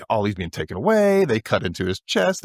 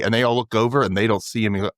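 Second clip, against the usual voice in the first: ordinary talk, nothing marked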